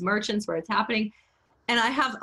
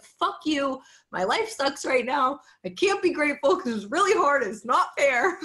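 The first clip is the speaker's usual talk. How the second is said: high, whiny mock complaint; comically aggrieved retort, pitched high; mock protest; 7 semitones higher than usual. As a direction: play a hammed-up sulk, breaking into a laugh. Acts out retort to trite advice.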